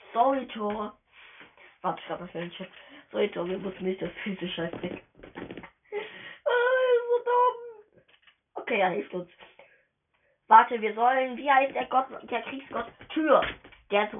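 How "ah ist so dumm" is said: hammed-up sob, high and slow; mock crying. Gamer mock-cries about Thor, high slow fake sob. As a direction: wail it like a fake-crying kid.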